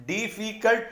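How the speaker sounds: "डिफिकल्ट" in three distinct beats, di-fi-cult; broken into syllables. Shown as a demonstration. Teacher confirms three syllables to students, broken into syllables. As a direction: separate each syllable of "डिफिकल्ट". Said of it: "तीन में टूटा".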